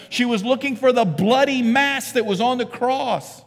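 loud, high, emphatic preacher's cry; full-force preaching. Real, not acted. Preacher hammering home who Mary was, full-force preaching. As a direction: drive the point home at full volume.

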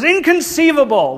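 high, loud incredulous exclamation; mock Italian outrage; Italian guy's catchphrase. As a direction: leap up high on it in disbelief. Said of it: "a short Italian guy who always says".

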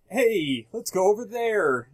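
high, chirpy fake game banter; cheesy scripted buddy. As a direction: ham up scripted sidekick chatter. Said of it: "talking how no one talks".

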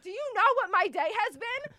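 indignant outburst. Retold or imitated. Retelling her outburst at the waiter, loud and indignant. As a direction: let the whole awful day spill out on the waiter.

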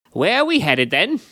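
high, impish little sprite voice. A narrator's reading. The sprite asks the startled driver, impish high voice. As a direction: play the cheeky fey creature perching uninvited.

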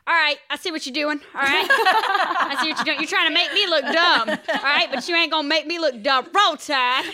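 Alabama fan. Retold or imitated. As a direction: play the wary Alabama fan who won't look dumb. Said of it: "they would be like".

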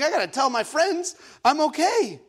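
high-pitched, fretting retelling; mock panic. Needs to tell friends, acted fretting. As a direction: voice the worry up high. Retold panicked thoughts, no phone.

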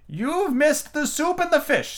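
very high, slow regal voice; haughty queen looking down; Red Queen chiding late Alice. A narrator's reading.

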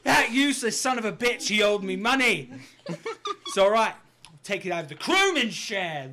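high, loud and laughing; a pirate cursing a dead mate; laughing pirate captain. Acted.

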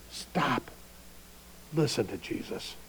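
God quietly correcting Andy; quiet divine rebuke. Pretend. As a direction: say it gently but with weight. God's voice speaks to Andy.

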